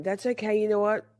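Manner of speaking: ordinary talk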